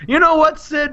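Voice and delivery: high, loud scheming opener; hammed-up wrestler's address; Lex Luger. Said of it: "Lex says".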